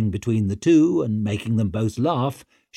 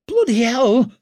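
high, loud squeak of shock; startled squeak; 13 semitones higher, 7 dB louder than usual. A narrator's reading. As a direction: squeak it out in fright. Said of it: "squeaked Tallow".

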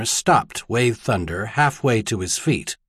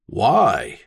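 ordinary delivery

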